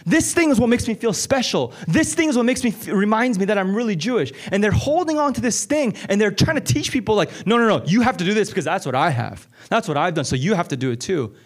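high, driving sermon voice; pleading with the congregation, urgent preaching; impassioned preaching. Acted. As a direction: preach it with rising fire. Preaching builds to full force.

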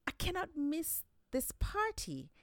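a spider who won't miss the fun; Anansi eager. Acted. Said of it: "he told them".

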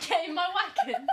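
high, loud mock command through laughter; hammed-up shout. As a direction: call it out, cracking up. Hammed echo of wagoner's invitation.

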